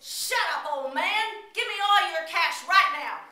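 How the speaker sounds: loud threatening demand; nervous stickup yell; robber shouting. Acted. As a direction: yell at the old man. Robber demands cash again.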